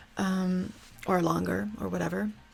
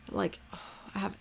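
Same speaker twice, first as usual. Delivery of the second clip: shaky sigh; 7 dB quieter than usual. Real, not acted. Sighs while describing anxiety.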